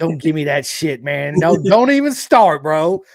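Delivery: loud, high playful protest; loud mock scolding that peaks high; mock outrage. Real, not acted. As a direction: cut him off with rising, teasing indignation. Playfully scolding the guest's modesty.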